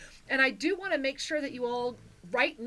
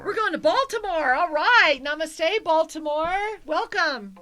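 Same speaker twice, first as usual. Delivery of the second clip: high, loud host's welcome; excited announcement; 5 semitones higher, 8 dB louder than usual. Real, not acted. Host welcomes next caller from Baltimore, excited announcing. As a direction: call out to the whole city with gusto.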